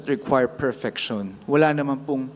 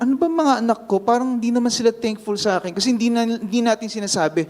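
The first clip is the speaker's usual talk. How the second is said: higher, fast hurt mother wondering aloud; mother's complaint; 7 semitones higher, much faster than usual. Retold or imitated. Preacher voicing a mother hurt by ungrateful kids, quick and higher. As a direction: voice a mother who feels unthanked.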